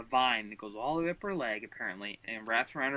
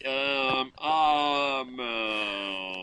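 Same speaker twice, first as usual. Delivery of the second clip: slow, drawn-out, goofy Iron Man line; dumb Iron Man voice; 4 dB louder, much slower than usual. Acted. Cohost doing the requested Iron Man bit, slow and dopey. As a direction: stretch every word like a dopey hero.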